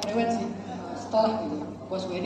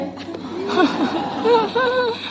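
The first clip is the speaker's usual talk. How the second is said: loud high laugh; 10 semitones higher, 4 dB louder than usual. Real, not acted. Speaker laughing as he tells the audience the joke is on him.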